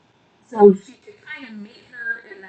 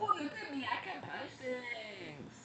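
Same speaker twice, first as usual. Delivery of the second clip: mocking voice; 3 semitones higher, 20 dB quieter, slower than usual.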